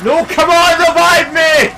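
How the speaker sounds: high, loud, exasperated demand; real frustrated shout; desperate yell. Real, not acted. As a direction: plead at full voice with a useless teammate. Downed player pleads with teammate.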